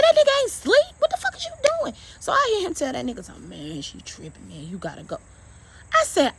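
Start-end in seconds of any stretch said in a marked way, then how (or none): 0.0-1.9 high, loud furious yell; angry yell. Retold or imitated. Wife yelling at husband over the pretend-sleeping intruder. 3.3-5.2 hushed mutter. Retold or imitated. Husband quietly telling friend to leave.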